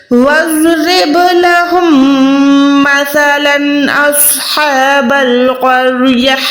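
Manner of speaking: slow measured tajweed reading; slow chanted verse; Quran recitation. Shown as a demonstration. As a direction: recite the phrase slowly, stop at the end. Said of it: "کیسے پڑھیں گے".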